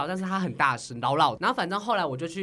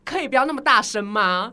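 retold comeback; 3 semitones higher, 7 dB louder than usual. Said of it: "我就转头跟他说".

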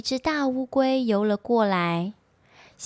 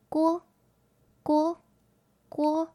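lightly imitated high croaks; short, high, separated frog calls; frog croaking; 7 semitones higher than usual. A narrator's reading. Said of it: "唱着歌".

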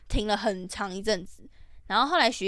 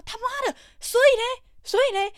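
much higher and louder, a shrill-free disbelieving shout; mock exasperated yell; 14 semitones higher, 6 dB louder than usual. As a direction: hurl it back at the absurd haggler. Retold exasperated retort. (拔高音量的夸张质问)

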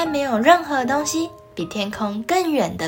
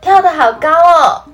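excited; 7 semitones higher, 10 dB louder than usual. Acted. Little rabbit voiced in awe.

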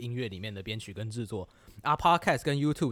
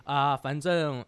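usual speaking voice

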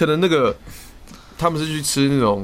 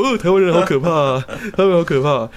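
mock scared teammates; 4 semitones higher than usual. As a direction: tease like a mock-frightened teammate. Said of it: "然后他们就说". (模仿日本队友假装害怕，声音较高较大)

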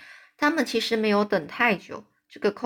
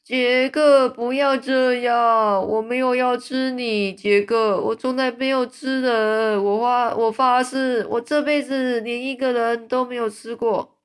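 much louder, higher frantic shouting; frantic yelling; 4 semitones higher, 5 dB louder than usual. A narrator's reading. Giant in nightmare yells to Jack he never ate anyone, frantic. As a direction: yell out desperate denials. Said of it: "这个巨人他疯狂大叫说".

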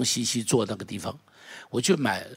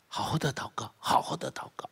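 hushed whisper, much softer; 9 dB quieter than usual. Real, not acted. Preacher urging the church to pray, whispering. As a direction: breathe the words 好好的祷告 in a whisper.

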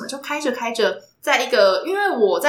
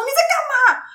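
retold yell; 13 semitones higher than usual. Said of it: "他就大叫说".

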